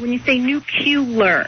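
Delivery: nuclear said as nu-cu-lar with an extra U, slow; nucular. Shown as a demonstration. Professor shows the wrong version, nuclear said nu-cu-lar. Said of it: "put an extra U in there before the L".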